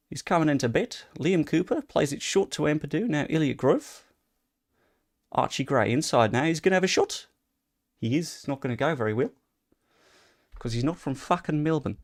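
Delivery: light Australian cricket-commentator voice; generic Australian accent. Acted. Showing chat his generic Australian accent on match commentary. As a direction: commentate the football like an Aussie cricket caller. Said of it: "generic Australian".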